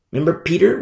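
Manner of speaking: normal talking voice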